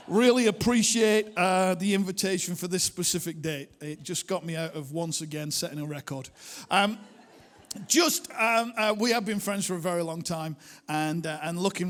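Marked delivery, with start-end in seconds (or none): none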